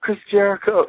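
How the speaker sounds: mock scream of a thrilled fan; retold excited scream. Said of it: "screaming, like".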